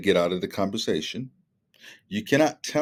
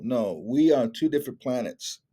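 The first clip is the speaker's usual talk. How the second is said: ordinary delivery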